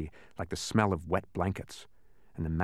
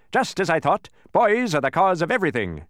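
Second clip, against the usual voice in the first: high, loud self-satisfied verdict; officious policeman; 9 semitones higher, 6 dB louder than usual. A narrator's reading. Officer blaming boys for everything, officious declaration. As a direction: declare it loudly as proof. Officer confirms his suspicion loudly.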